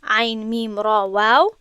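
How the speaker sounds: عین میم را واو spelled out slowly; slow letter spelling. Shown as a demonstration. Teacher spelling out the name for students.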